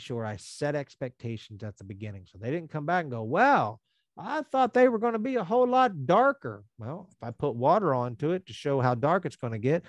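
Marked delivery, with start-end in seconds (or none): 3.2-6.6 complaining customer. Retold or imitated.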